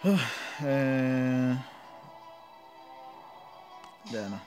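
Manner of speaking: ordinary talk, nothing marked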